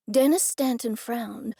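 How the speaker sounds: plain reading voice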